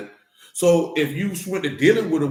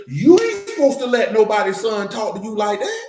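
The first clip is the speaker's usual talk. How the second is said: usual speaking voice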